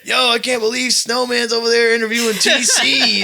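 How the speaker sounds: high, loud, laughing amazement; mock excited friend. Retelling a friend amazed to see TC interviewed. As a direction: gush it like a starstruck fan.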